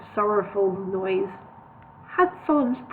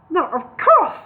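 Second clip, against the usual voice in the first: sharp raised snap, louder; snapped; 7 semitones higher, 6 dB louder, slower than usual. A narrator's reading. Solomon snaps at Bingo, louder. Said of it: "snapped Solomon".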